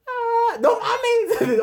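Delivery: high, loud cry of protest, half laughing; hammed-up shriek of frustration; mock outraged shout. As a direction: yell as if yanked out of the music. Mock shout at being interrupted.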